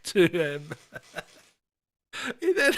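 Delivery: breaking into laughter mid-sentence; can't get the words out for laughing. Real, not acted. Cracking up mid story.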